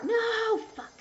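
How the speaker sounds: jumps up high in a sudden yelp; startled curse. Real, not acted. Gamer blurts out when a move goes wrong, startled and high. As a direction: caught off guard by her own misplay.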